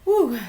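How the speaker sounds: loud high whew after fake tears; mock emotional exhale. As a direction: blow it out as if pulling yourself together. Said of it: "my emotional walls had crumbled there".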